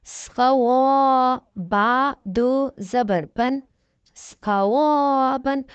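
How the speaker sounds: slow sing-song spelling of each letter; slow syllable drill. Shown as a demonstration. Tajweed teacher drilling students syllable by syllable. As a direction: spell out each syllable slowly, like a Quran class.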